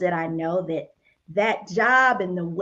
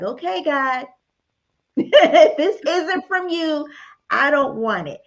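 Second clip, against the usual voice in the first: laughing prayer; 5 semitones higher than usual. Retold or imitated.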